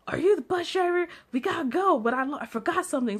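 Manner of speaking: put-on high, odd drunk voice; drunk guy. Drunk guy to the bus driver, high and odd. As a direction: play the drunk confronting the driver. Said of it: "the guy's like".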